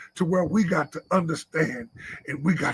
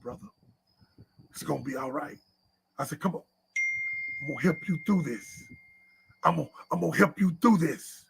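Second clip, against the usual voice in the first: softer, lower, comforting a scared man; gentle reassurance; 4 semitones lower, 5 dB quieter, faster than usual. Retold or imitated. Pastor comforts friend crying in the truck, soft and reassuring. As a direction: soothe him like a brother.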